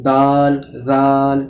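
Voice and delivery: দাল, জাল very slowly, thin-mouthed; slow Arabic drill. Shown as a demonstration. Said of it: "মুখটাকে পাতলা করে বলতে হবে".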